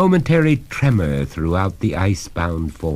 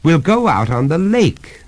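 lightly voiced master, higher and louder; raised voice as he starts up; master starting up; 11 semitones higher, 5 dB louder than usual. A narrator's reading. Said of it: "said the master, starting up".

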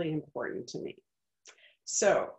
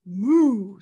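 louder, drawn-out moo; cow's moo; 10 dB louder than usual. Acted. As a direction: moo like a cow in the plan. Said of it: "said the cow".

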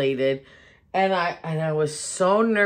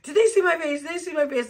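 put-on high, fretful boyfriend voice, pitched way up; mocking boyfriend; 11 semitones higher, faster than usual. Wife mimics her camera-shy boyfriend, high mock panic. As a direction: imitate him panicking about the camera. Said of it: "He's like".

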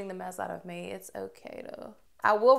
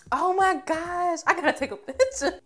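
high loud gush over the color; 9 semitones higher, 6 dB louder than usual. Real, not acted. Gushing over the lip color.

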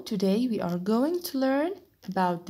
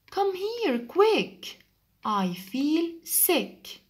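high, urgent "Come here, quick", slow "I feel sick"; high pleading call then a slow sick groan; urgent duck; 6 semitones higher, much slower than usual. Acted. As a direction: sound like a sick little duck begging. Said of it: "Said the duck to the chick".

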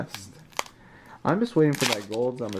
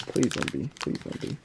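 hushed plea; 7 dB quieter than usual. Real, not acted. Praying pack isn't last Vmax.

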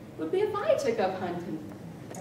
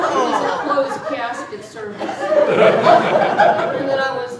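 louder, still laughing, slowing down; laughing through words; 12 dB louder, slower than usual. Acted. Widow still laughing as she names herself the Widow Crane. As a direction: gloat through leftover laughter.

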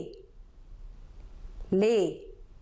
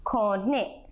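"Kone" drawn out slowly for learners; 2 semitones lower than usual. Shown as a demonstration. Teacher shows learners Burmese number seven, "Kone" said slowly. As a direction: stretch out "Kone" so each sound is clear. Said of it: "I will say it slowly".

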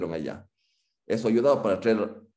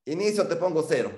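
angry threat; 7 semitones higher than usual. Retold or imitated. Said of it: "Mi profesor bravo me amenazó y me dijo".

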